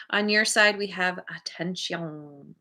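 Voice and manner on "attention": drawn-out, mock French pronunciation of "attention"; playful attempt at a French accent. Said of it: "In my best French accent".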